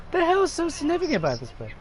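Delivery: high, incredulous mock question; baffled disbelief. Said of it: "they walk around and go".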